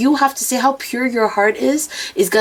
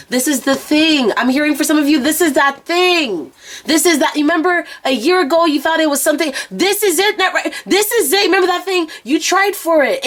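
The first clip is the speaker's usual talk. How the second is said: intense, pitched-up psychic reading voice; channeling message; 7 semitones higher than usual. Acted. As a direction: deliver the spirit's message with urgency. Channeled message, held high voice.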